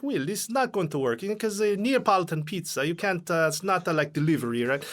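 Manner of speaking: put-on Italian accent. Restaurant manager doubting pizza delivery, in an Italian accent. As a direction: play a skeptical Italian pizza man in a thick Italian accent. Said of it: "in the accent".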